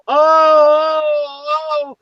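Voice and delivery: long high-pitched mock moan; drawn-out falsetto "oh"; mock lover's scream. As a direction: imitate a screamer through the wall. Imitating a screamer heard through wall.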